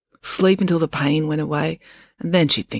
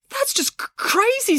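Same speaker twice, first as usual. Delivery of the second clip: stunned stammer. A narrator's reading. Joe stunned.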